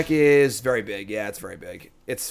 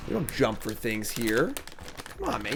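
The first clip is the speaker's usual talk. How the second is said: normal talking voice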